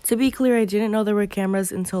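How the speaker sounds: loud insistence. Real, not acted. To viewers, denying she knew about the cameras, loud and emphatic. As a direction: insist you had no idea.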